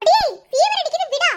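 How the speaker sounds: startled cry at her hot forehead; alarmed exclaim. Acted. Aunt alarmed at fever. (காய்ச்சல் கண்டு பதறிய குரல்)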